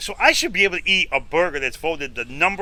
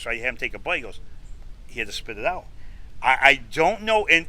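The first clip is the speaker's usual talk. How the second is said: ordinary talk, nothing marked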